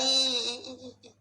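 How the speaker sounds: high mock sobs fading away; imitated crying. Man mimicking the ghost who will not answer, mock crying. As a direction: mimic a high wail that trails into whimpers. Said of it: "Ditanya nangis kenapa diam".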